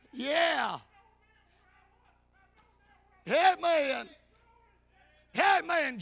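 loud, high exclamations with long pauses; hollering praise. Real, not acted. Preacher hollering hallelujahs in church, full-force praise. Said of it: "all that hollering".

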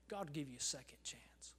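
soft hushed whisper. Real, not acted. Preacher to doubting listeners, whispered. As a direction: lean in and whisper the promise.

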